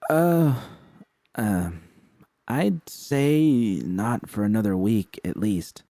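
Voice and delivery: slow, halting reply; hesitant drawn out. Acted. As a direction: stall while thinking it over.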